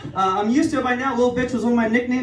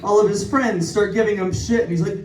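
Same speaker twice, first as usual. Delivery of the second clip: ordinary delivery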